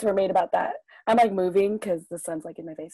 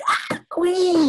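high, gushing cheer; hyped retold admiration; adoring fan reaction; 8 semitones higher than usual. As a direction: gush over your idol. Acted adoring reaction to teacher.

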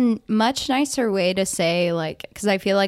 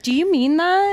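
incredulous; 10 semitones higher, 5 dB louder than usual. Retold or imitated. Mocking reply to Jack's sarcasm.

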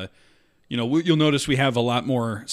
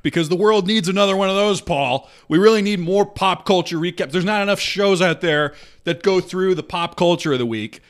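higher, louder sarcasm; sarcastic complaint; 5 semitones higher, 5 dB louder than usual. Real, not acted. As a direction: pile on the mock enthusiasm, loud and high. Sarcastic complaint about rival show.

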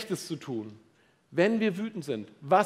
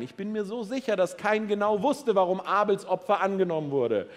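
ordinary talk, nothing marked

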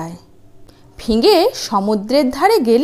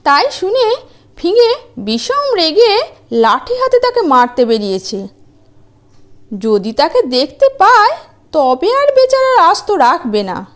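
scheming wife; 8 semitones higher than usual. Acted. Wife fooling giant, mock warning.

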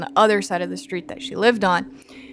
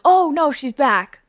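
high, slowed fake dismay; mock alarm; 6 semitones higher than usual. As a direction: pretend the kidnap victim returned. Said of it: "we were like".

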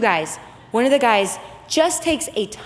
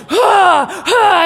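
straining yell; 6 semitones higher, 7 dB louder than usual. Retold or imitated. Guide pulling man out.

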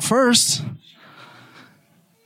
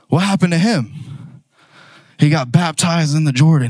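ordinary delivery

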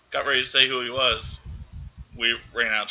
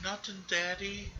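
put-on high, much softer, slow little-girl reply; timid child; 8 semitones higher, 13 dB quieter, slower than usual. Voicing the frightened daughter answering her dad, high and hushed. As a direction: shrink back like a scared kid.

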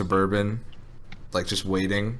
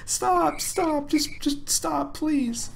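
much higher, pleading girlfriend imitation; mock girlfriend pleading; 4 dB quieter, much slower than usual. Retelling the drunk guy's girlfriend begging him, high mock plea. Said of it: "His girlfriend the entire time was like".

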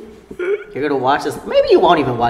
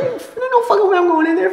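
mock high panic; faster than usual. Refusing to go in, mock voice.

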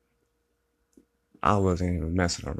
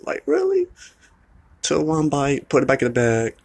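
squeaky high, louder disbelief; can't believe he did that; incredulous outburst; 8 semitones higher, 6 dB louder than usual. Real, not acted. Telling viewers about the thrown Taco Bell, high incredulous.

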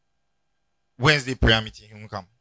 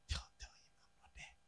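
breathy hidden whisper, barely voiced; hushed whisper; 29 dB quieter than usual. Wife hiding in the room whispers to send the bishop away. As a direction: whisper it so the visitor won't hear.

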